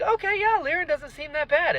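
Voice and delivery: high, hopeful inner voice; surprised, high-pitched hope. Retold or imitated. Reader meeting Lirin, high and hopeful. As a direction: lift the pitch in naive hope. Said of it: "I was like".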